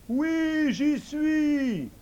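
invisible servant. Acted. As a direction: answer the master high and ready. Servant answers idiot.

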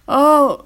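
loud high moan of pain; crocodile's groan. A narrator's reading. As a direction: groan as he's knocked flat. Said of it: "gémit le crocodile". (gémissement de douleur)

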